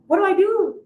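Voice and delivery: high, loud, playful panic; mock nervous excitement. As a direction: raise pitch and volume in pretend bewilderment. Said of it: "excited and also a little bit of".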